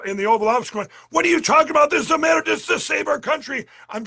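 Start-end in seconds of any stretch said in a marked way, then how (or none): 1.1-3.7 raised, higher mock yell at the advisor; retold yelling. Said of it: "I'm yelling in the".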